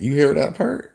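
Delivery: ordinary talk, nothing marked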